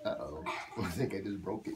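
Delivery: usual speaking voice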